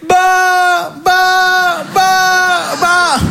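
high, held "baaah", note after note; long sustained high bleats on one pitch; drawn-out high "bah". Acted. Comedian opens the bit with held syllables, high drawn-out bah.

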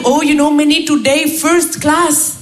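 put-on slow, blissful Indian-accented English; lightly imitated Indian accent; Indian colleague. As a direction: float on pure contentment. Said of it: "tous les jours, il arrive".

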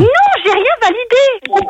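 real exasperated denial; indignant protest. Real, not acted. Protests against a prank sales call.

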